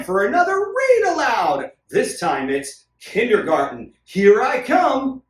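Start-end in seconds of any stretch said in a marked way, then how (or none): none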